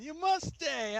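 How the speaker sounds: high playful order; mock command. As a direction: chirp the order like a joke.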